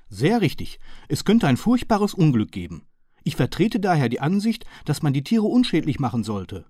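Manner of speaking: polite apologetic gentleman. A narrator's reading.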